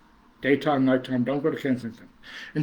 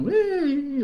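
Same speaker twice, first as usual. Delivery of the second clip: playful whee; 12 semitones higher than usual. Retold or imitated. Mimicking daughter's carefree travel.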